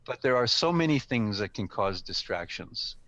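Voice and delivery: ordinary talk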